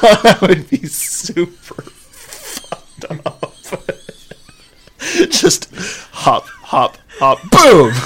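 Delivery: giggling through the words; cracking up while talking; laughing. Real, not acted. Laughing at minefield joke.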